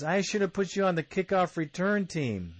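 high-pitched bantering jab; Al Davis. Retold or imitated. Al Davis kids Woodson at camp, bantering. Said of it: "he's bantering back and forth".

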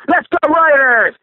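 high, drawn-out fan cheer; rallying cheer. Real, not acted. Caller signing off a rant to fellow Raiders fans, rallying cheer. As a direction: cheer the team on, long and high.

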